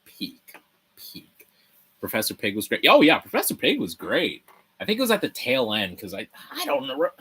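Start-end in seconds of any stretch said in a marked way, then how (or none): 1.0-1.5 whispered echo of the word. Real, not acted. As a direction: breathe the word back as a whisper.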